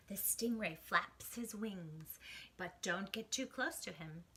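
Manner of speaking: ordinary delivery, nothing marked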